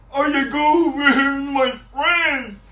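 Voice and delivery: high, drawn-out mock friendly voice; mocking fake friend. Speaker mocks a friend-begging fake, high and mocking.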